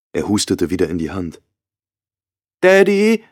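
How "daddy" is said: put-on much higher, little child's voice; a three-year-old reaching for her daddy; high, small toddler's call; toddler asking. A narrator's reading. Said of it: "verlangte Baby Lavanne".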